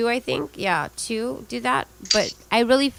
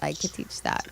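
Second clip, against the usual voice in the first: whispered aside; 5 semitones lower, 9 dB quieter than usual. Real, not acted.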